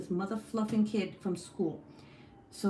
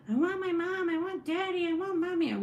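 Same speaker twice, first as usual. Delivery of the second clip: high, imitated kid whine; whiny child; 10 semitones higher than usual. Mom mimics her son begging for his parents, whiny child. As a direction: plead like a little kid at bedtime.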